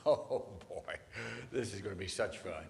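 a hint of a sly, gleeful devil; gleeful Satan. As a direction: relish it like a schemer. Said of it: "Satan's rubbing his hands together going".

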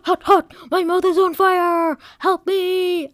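loud, high cry for help; high-pitched, panicked holler; yelling. Acted. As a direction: yell as if your mouth is burning. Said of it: "I yelled".

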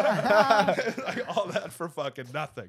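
laughing, high and loud. Real, not acted. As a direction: speak through a laugh. Laughing after punchline.

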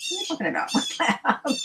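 high-pitched mock disbelief with a laugh; baffled questioning. Naive listener questions the neighbor's lab claim, baffled. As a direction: act out being totally thrown, voice leaping up.

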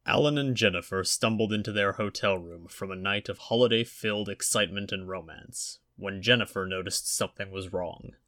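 usual speaking voice

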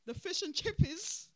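loud, slow, mocking nickname; mocking punchline. Real, not acted. Vlogger mocking a party's nickname, loud slow punchline. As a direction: land the silly name hard.

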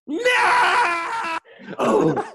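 a high, hammed-up Luke scream; mock despairing yell; retold anguished scream. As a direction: shout No like Luke in Empire. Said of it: "jumped out of my seat screaming".

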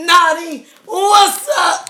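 loud, high hype intro; excited shout. Real, not acted. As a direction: hype up the guest. Host hypes up returning guest.

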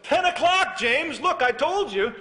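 high, loud scolding dad shouting at the door; a father barking his son out of bed; angry dad. Retold or imitated. Dad scolding late-sleeping son, loud and high-pitched.

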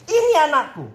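high, delighted proud-parent boast; acted parental pride. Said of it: "betapa bangganya orang tua".

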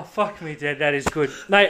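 ordinary talk, nothing marked

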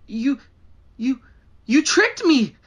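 loud angry outburst; angry accusation. Acted. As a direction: shout betrayed. Betrayed victim yells.

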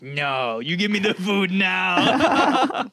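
drawn-out, rising mock yell, laugh at the end; defiant mock demand. Imagining Kat standing her ground.

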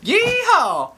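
high, loud country holler. Acted. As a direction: whoop like a hillbilly. Redneck finds genie.

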